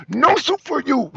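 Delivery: clipped stern soup Nazi refusal; hammed-up stern refusal; soup Nazi catchphrase. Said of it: "Seinfeld episode".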